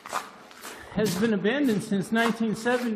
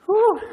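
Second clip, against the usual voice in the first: a sharp high puff of breath, falling away; breathless whew; 12 semitones higher, 6 dB louder than usual. Real, not acted. Runner in a dark tunnel, winded, blowing out a high whew. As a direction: blow out hard like you just ran a long way.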